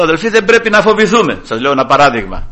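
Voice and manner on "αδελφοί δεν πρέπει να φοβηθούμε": raised, urgent rallying call; rally the congregation in a higher voice; pulpit exhortation. Retold or imitated. Preacher quoting his call to the brethren not to fear, exhorting.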